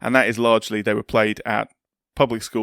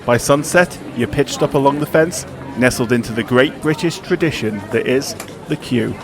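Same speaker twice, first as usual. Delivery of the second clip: dramatic scripted intro read over music; documentary narration; 3 semitones higher, 4 dB louder than usual. Acted. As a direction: read it like a sports documentary voiceover. Scripted podcast cold open.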